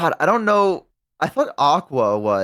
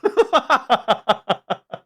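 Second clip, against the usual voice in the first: squeaky burst of laughter; high-pitched laugh; 12 semitones higher than usual. Real, not acted. Laughing at Ellie.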